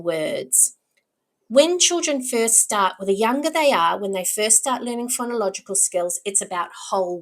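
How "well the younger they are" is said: ordinary talk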